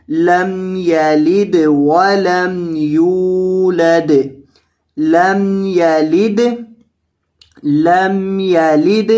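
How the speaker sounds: لم یلد recited slowly without any nasal hum; no nasal sound. Shown as a demonstration. Teacher reciting لم یلد without ghunna. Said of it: "گنہ نہیں ہوگا".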